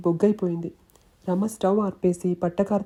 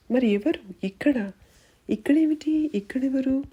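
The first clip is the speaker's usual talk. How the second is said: rising high in alarm; frantic, disbelieving questions; 6 semitones higher than usual. A narrator's reading. A friend asks high-pitched who it was.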